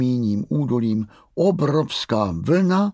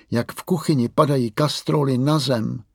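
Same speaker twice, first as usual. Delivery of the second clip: usual speaking voice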